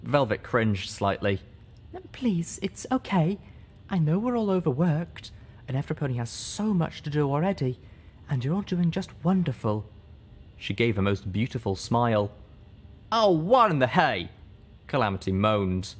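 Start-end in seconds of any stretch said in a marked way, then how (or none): 2.1-9.8 soothing shy speaker. Acted. Velvet as Fluttershy. 13.1-14.3 higher, louder exasperated groan; fed up and dismayed; moaning exasperation. Acted. Calamity moans.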